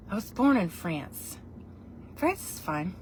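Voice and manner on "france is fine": normal talking voice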